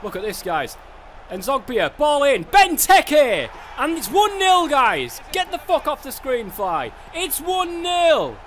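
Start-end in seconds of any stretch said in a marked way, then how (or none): 1.3-3.5 rising, louder, calling the goal; excited goal call. Real, not acted. Player cheering his own goal, a loud excited call. 3.8-8.4 higher and louder, savouring the score; excited celebration. Real, not acted. Gamer celebrating the opener to his viewers, real excitement.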